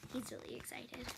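soft unvoiced whisper. Real, not acted. Aside to the viewers about the excited friend, whispered. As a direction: lean in and whisper it.

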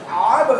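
আব with the b bouncing back, loud; teacher demonstrates qalqala; qalqala echo. Shown as a demonstration. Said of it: "কলকলা মানে".

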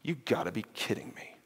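a quiet, crushed under-the-breath groan; sinking disbelief. Retold or imitated. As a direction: let the heart sink under it. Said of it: "his heart sinks and goes".

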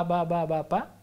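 "ba, ba, ba" then "pa", each syllable clipped apart; syllable demo. Shown as a demonstration. Professor illustrating the experiment stimulus to the host. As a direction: repeat "ba" in a steady beat, then drop in "pa". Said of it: "y le pones ba".

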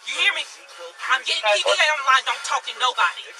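forceful loud commands; loud urgent orders. Real, not acted. Officer urgently orders shaken colleague.

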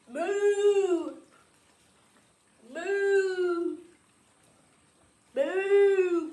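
drawn-out mooing like a cow. Pretend. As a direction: moo like a cow on request. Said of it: "Act like a cow for ten seconds".

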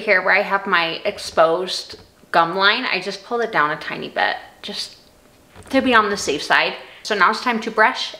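teeth covered by thin strips; slight lisp from strips; talking with whitening strips on. Real, not acted. Seen 2: Finger on teeth adjusting strip; teeth glossy with strips on.

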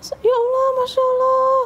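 high squealing disbelief, half-screamed; a cousin shrieking at midnight news, high and excited. Retold or imitated. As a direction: shriek it out in joyful shock. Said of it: "sampai ngejerit gitu kan".

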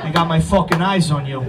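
put-on a lightly done low Brooklyn tough-guy accent; a hint of Brooklyn street warning, low. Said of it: "That is a real accent where I'm from".

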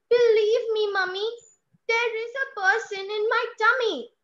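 put-on high, urgent child's plea; pleading child. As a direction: beg her one last time. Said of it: "voice modulation from mummy to child".

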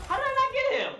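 can't believe he missed the kill; stunned disbelief. Real, not acted.

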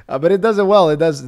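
pleased surprise. Real, not acted. Delighted the translation worked.

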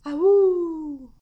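long, high, loud howl; full-voiced wolf call; imitated wolf howl. Shown as a demonstration. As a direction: howl like a wolf, falling away.

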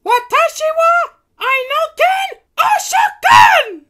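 slow, very high battle cry; screaming. A narrator's reading. Princess Kitty raising her scepter to transform it, screaming. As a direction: scream it like a magical heroine's incantation.

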